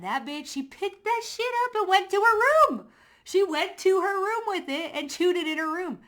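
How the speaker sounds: high, loud, excited storytelling; gushing in disbelief; amazed retelling. Real, not acted. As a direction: marvel at the dog obeying. Retelling dog obeying, amazed.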